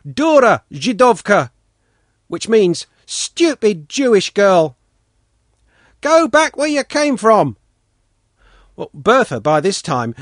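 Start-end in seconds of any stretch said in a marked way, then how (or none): 0.0-1.5 spiteful shouted taunt; shouted insult. A narrator's reading. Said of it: "shouted from behind it". 3.1-7.6 put-on high, loud jeering boy; shouted taunt. A narrator's reading. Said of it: "shouted from behind it".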